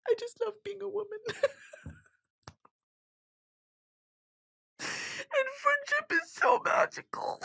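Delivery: real tears breaking through while gushing about friends; laughing through tears. Real, not acted. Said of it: "Yeah, I'm gonna cry".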